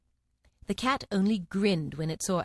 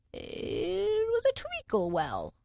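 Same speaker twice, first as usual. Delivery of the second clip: very slow, drowsy dormouse; sleepy dormouse; 12 semitones higher, much slower than usual. A narrator's reading. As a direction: drag it out sleepily.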